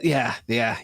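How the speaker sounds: normal talking voice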